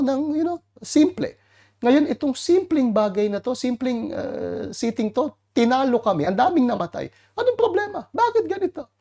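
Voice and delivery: high, pleading, whiny complaint; Joshua complaining to God. Retold or imitated. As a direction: cry out to God like a bewildered, hurt leader. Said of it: "nagreklamo ngayon sa Diyos". (mataas at nagrereklamong boses ni Joshua kay Lord)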